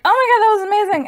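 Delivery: high, fast gush of praise; raving excitement. Retold or imitated. Reviewer raving about an ending she loved, high and fast. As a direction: rattle it off thrilled. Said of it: "where I'll be like".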